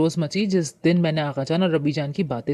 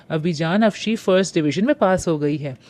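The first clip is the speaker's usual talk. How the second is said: ordinary delivery, nothing marked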